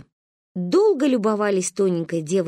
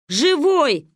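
higher, firm shout back; reassuring call; 5 semitones higher, 4 dB louder than usual. A narrator's reading. Rescuers reassuring the family, calling out. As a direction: call the good news across. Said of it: "успокоили нас спасатели".

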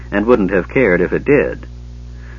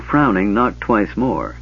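ordinary delivery, nothing marked

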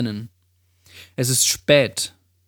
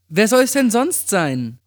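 put-on high-pitched girlfriend's retort; Amy; 7 semitones higher than usual. A narrator's reading. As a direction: voice the friend high and incredulous. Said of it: "Ich erkannte Amys Stimme".

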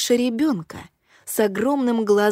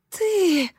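shocked gasp; 10 semitones higher than usual. A narrator's reading. Dying Satomi recognizes killer.

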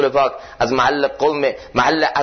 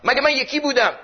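high, whiny demanding plea to God; much higher, protesting voice of an entitled petitioner; demanding complaint; 13 semitones higher than usual. Retold or imitated. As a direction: complain to God like someone owed a debt. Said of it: "مثل طلبگار دست بلند نکن جلوی خدا".

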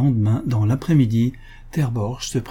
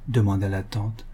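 plain reading voice

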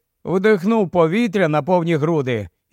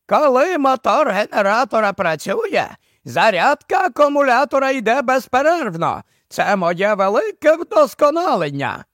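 high-pitched professor voice; 6 semitones higher than usual. Acted. Professor explains his invention to Barney, high-pitched character voice. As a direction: voice an old-school boffin, pitched up. Professor's lines in narrated story.

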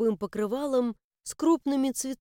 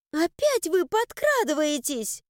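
higher, startled indignation; indignant; 6 semitones higher than usual. A narrator's reading. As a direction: snap it back, outraged. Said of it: "возмутилась".

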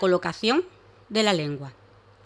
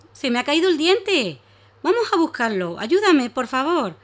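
higher, louder pleading dragon; pleading little dragon; 7 semitones higher, 6 dB louder than usual. Acted. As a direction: beg her to help search. Danilo begs for help.

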